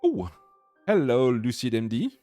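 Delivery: ordinary talk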